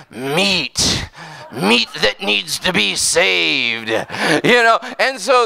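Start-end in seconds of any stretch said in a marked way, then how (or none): 0.1-3.9 lightly voiced hungry hunters; hungry predator. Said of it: "it's like".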